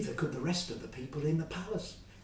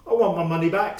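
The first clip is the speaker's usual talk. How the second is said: demanding; 3 semitones higher, 14 dB louder, faster than usual. Acted. Fred threatening Joe.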